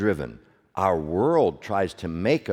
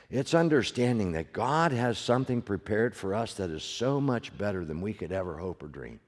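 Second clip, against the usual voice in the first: ordinary delivery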